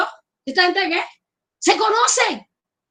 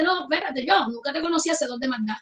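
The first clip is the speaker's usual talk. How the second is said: lower, softer, firm rebuke; a hint of a stern judge; stern Lord; 5 semitones lower, 7 dB quieter than usual. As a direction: turn them away calmly. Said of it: "the Lord answers the boaster".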